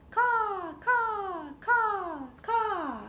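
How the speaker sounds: very high, loud cawing; harsh loud crow calls. Shown as a demonstration. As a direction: caw it out like a crow. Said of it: "Crow calls out".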